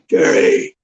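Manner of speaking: high, airy call of a name from another room; retold distant call for help; mock call from afar. Friend calling his name at night.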